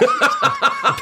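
high burst of laughter. Real, not acted. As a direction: let out a big high laugh. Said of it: "laugh at own story".

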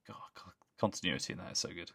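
breathy hushed awe; hushed, impressed murmur; awed whisper. Real, not acted. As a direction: whisper it in amazement. Awed whisper about continuity.

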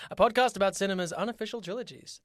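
dramatic show opening with a sudden whisper; podcast intro. Acted. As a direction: announce the show like a trailer.

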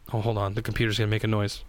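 usual speaking voice

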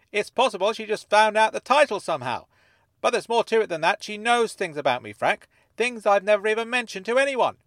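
high, loud character voice; insistent Dennis. Acted. Dennis telling Frank what she knows, loud and insistent. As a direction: argue it out loudly and earnestly.

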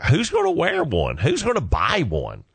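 high-pitched, swooping mock disbelief; mock incredulous. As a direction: squeak it out as exaggerated, comic doubt. Acts out his doubt about merch.

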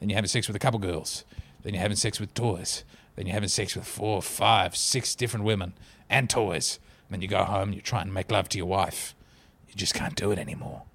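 imitating Jake the Snake. Quoting the wrestler's documentary confession in a low, soft imitation of his accent. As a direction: growl it low and quiet like a worn-out wrestler. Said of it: "I don't think he had that accent".

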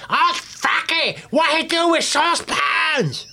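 very high, drawn-out mock shriek; shouting. Cook heard raging in the kitchen, imitated high-pitched shouting. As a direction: imitate a cook losing the plot, screeching. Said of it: "who he's shouting at".

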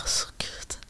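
barely voiced, very soft imitation; retold hushed sigh. Wife imitates husband wanting peace at home, hushed sigh. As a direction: imitate him under the breath, almost without voice.

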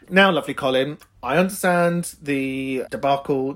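ordinary talk, nothing marked

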